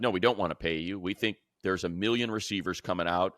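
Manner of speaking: ordinary talk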